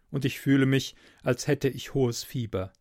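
usual speaking voice

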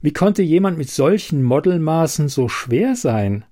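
ordinary delivery, nothing marked

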